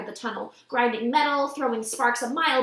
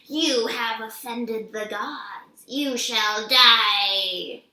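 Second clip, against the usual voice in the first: put-on very slow Southern accent, last words louder; menacing Southern accent; 4 dB louder, much slower than usual. A narrator's reading. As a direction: pronounce doom in a thick Southern accent. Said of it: "in an accent that was definitely from somewhere farther south".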